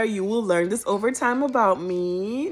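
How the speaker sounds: ordinary delivery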